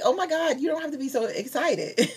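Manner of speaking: retold reaction to an over-the-top friend; taken aback. Acts out reply to excited friend.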